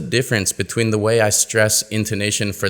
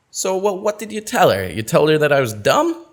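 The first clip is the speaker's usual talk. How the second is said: indignant reply; 5 semitones higher than usual. Acted. Acted reply in dialogue.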